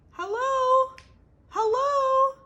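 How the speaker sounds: high loud hello calls; calling from the pile. Acted. Beatrice calling from under the pile, high and loud. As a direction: call from under the junk.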